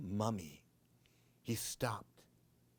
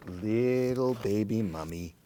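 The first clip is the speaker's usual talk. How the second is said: plain reading voice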